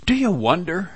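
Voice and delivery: ordinary talk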